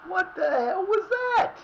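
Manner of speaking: very high, slow disbelieving cry; shocked cry. Real, not acted. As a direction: wail in disbelief at the screen. Stunned at a bad play.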